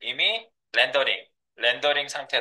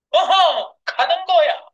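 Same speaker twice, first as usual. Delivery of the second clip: hyped shout; 11 semitones higher, 5 dB louder, slower than usual. Acted. Hyped trailer call.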